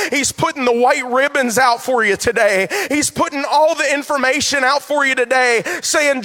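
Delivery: loud, rising preacher's call; fervent loud pulpit preaching; preaching at full force. Acted. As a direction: build it like a revival climax. Preaching continues.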